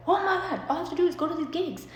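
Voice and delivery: high, fast gushing. Retold or imitated. Telling mom about the house, high and giddy. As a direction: rush it out, giddy. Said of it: "I'm like".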